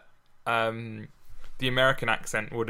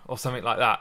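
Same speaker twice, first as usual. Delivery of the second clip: ordinary talk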